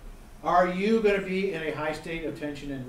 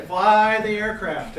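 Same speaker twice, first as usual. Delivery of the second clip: louder, slow and drawn out, punching Fly; loud, slow command; 4 semitones higher, 9 dB louder, much slower than usual. Shown as a demonstration. Instructor showing student pilots the answer, loud and slow. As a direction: spell out the first priority like an order. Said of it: "Let's hear the glider".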